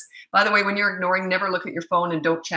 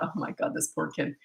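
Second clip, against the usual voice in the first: much softer, lower dismayed murmur; hushed inner thought; 3 semitones lower, 9 dB quieter than usual. Retold or imitated. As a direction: think it to yourself in pity. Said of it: "And I thought".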